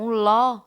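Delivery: "ló" held long and punched out loud; stressed syllable. Shown as a demonstration. Teacher isolating the strong syllable of zoológico, loudly stressed. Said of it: "é a sílaba antepenúltima".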